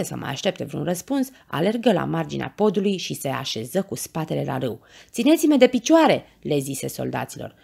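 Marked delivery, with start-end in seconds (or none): 5.1-6.2 high, loud command to soldiers; urgent order. A narrator's reading. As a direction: call out for help mid-rescue. Urgent order during the rescue. (poruncă strigată, urgentă)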